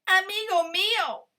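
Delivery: high, loud yelp. Acted. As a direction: squeal it out in sudden alarm. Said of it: "yelped Cuy".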